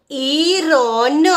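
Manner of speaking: "ઈરોન" slowly with a long opening ઈ; "ઈરોન" as a drawn-out phonics demo; sounding out. Shown as a demonstration. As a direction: stretch every sound in "ઈરોન". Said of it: "આઈનું phonic ઉચ્ચારણ થશે ઈ".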